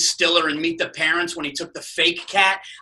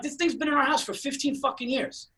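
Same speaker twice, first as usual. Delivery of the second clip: higher-pitched retold protest; indignant comeback; 7 semitones higher, 5 dB quieter than usual. Husband defending the cat to his angry wife, indignant. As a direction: argue back, incredulous and pleading.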